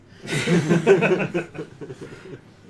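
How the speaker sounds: big burst of laughter; loud laugh. Real, not acted. Laughing at the sass remark.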